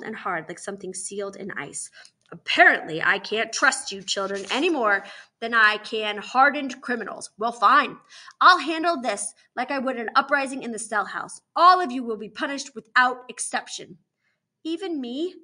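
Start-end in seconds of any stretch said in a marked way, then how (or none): 2.3-14.0 loud, emphatic threat; forceful scolding authority; stern warden, angry. Acted. 14.6-15.3 higher, timid little question; small worried voice; quavering. A narrator's reading. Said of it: "Teresa's voice is quavering".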